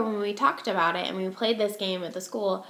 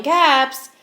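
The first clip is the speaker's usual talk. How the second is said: higher, louder street call, slow on caps for sale; 6 semitones higher, 5 dB louder, slower than usual. Acted. Peddler calls out his wares walking back to town. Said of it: "he walked back to town calling".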